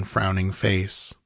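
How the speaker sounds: usual speaking voice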